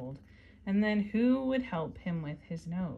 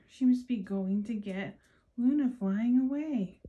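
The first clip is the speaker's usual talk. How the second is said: ordinary delivery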